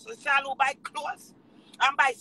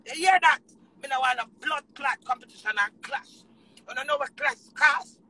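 ordinary talk, nothing marked

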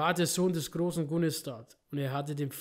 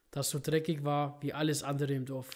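ordinary talk, nothing marked

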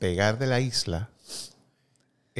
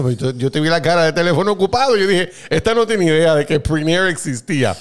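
fast, higher and louder teasing; 6 semitones higher, 8 dB louder than usual. Real, not acted. Teasing her for never hearing of Prinair, fast and loud. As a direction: poke fun at her blank look. Teasing friend's blank face.

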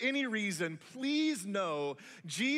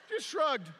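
laughing; 7 semitones higher, 3 dB louder than usual. Real, not acted.

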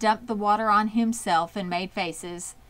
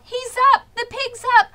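much higher, loud cheering yell; crowd yelling; 6 dB louder than usual. A narrator's reading. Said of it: "yelled the crowd".

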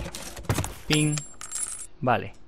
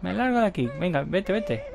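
indignant guest; 7 semitones higher than usual. Acted. Voices guest storming off.

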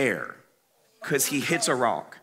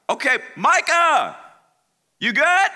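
higher, louder check-in call; 9 semitones higher, 4 dB louder than usual. Retold or imitated. Calling to a silent friend after his crash.

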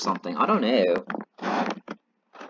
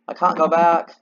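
pitched up and louder in panic; higher, louder alarm; 6 semitones higher, 6 dB louder than usual. Real, not acted. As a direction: cry it out, alarmed.

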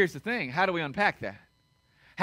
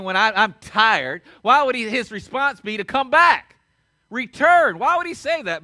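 preaching crescendo; 2 semitones higher, 7 dB louder than usual. Real, not acted. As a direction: build the sermon to a passionate peak. Pastor preaching with rising force.

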